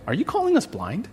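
mock the Pharisees taking offence; indignant Pharisees.

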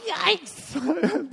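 high, sudden comic yelp; mock-scared yelp. Preacher picturing himself in jail, mock-scared yelp. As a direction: yelp it in exaggerated alarm.

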